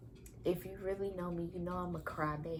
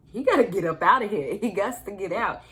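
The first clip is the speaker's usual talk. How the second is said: loud, fast fierce resolve; determined outburst; 11 dB louder than usual. Retold or imitated. Mother in labor psyching herself up, loud fast resolve. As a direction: force it out with grim determination. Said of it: "and I'm just like".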